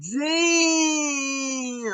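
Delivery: long, high drawn-out dang; stunned shout. Retold or imitated. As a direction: shout in disbelief. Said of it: "I was like".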